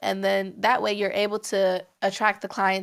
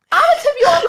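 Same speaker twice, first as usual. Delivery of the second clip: mock client voice; 13 semitones higher, 8 dB louder, much faster than usual. Client quote mocked.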